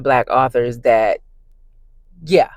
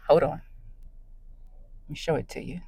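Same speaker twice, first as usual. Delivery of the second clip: ordinary delivery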